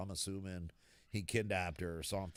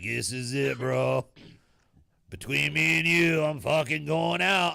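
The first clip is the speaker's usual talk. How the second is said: Kenny says he's going out; 5 semitones higher, 11 dB louder than usual. Retold or imitated. Sick friend calls to say goodbye.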